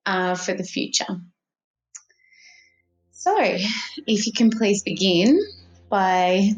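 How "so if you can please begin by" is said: calm, measured instructions, phrase by phrase; guided meditation. Acted. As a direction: ease the listener into relaxation, unhurried.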